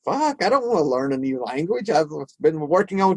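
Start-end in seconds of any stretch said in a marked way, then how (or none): none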